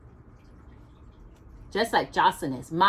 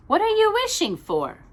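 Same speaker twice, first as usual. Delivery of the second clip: higher, louder question; Mother Bear asking; 13 semitones higher, 3 dB louder than usual. Acted. Mother Bear asks Little Bear why he cannot sleep, higher. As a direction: ask with lifted voice.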